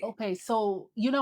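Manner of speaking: ordinary talk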